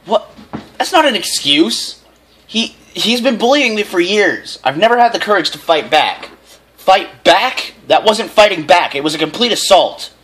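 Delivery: stern mother telling off her son; scolding mother. A narrator's reading. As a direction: scold him like an angry mom.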